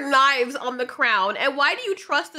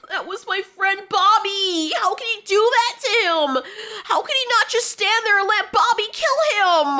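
mock grieving orc; 7 semitones higher, 4 dB louder than usual. Orc mourning friend Bobby.

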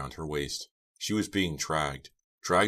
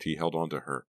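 plain reading voice

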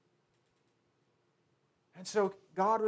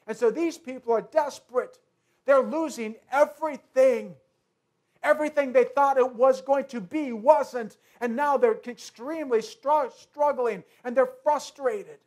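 rising preaching; 2 semitones higher, 5 dB louder than usual. Acted.

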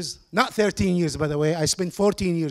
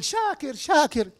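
softly mimicked high call of a name; imitated calling out; 7 semitones higher, 4 dB quieter, slower than usual. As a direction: mimic a wife calling through doorways. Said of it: "and she called".

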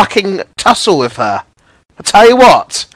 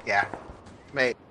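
normal talking voice

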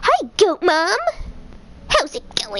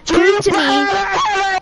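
dramatic cry; 7 semitones higher, 4 dB louder, much slower than usual. Acted. Dramatic boss line read.

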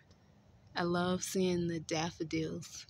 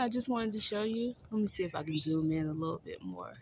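ordinary talk

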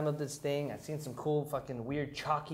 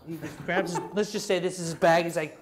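ordinary talk, nothing marked

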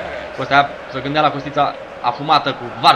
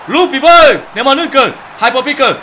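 short high, loud howling calls; excited yelling; 10 semitones higher, 6 dB louder, slower than usual. Real, not acted. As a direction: egg the team on like a pack. Barking calls as team attacks.

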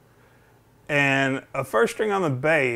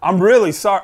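louder, higher retold apology; imitated apology; 6 semitones higher, 8 dB louder than usual. Store rep apologizing to customer, imitated emphatic higher voice. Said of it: "this guy apologized".

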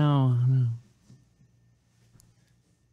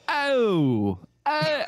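imitating a friend; 11 semitones higher than usual. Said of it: "he was just like".

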